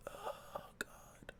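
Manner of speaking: unvoiced exhausted oh God; weary whisper. Dad recalling three-hourly feeds, whispered despair. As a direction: whisper it, drained.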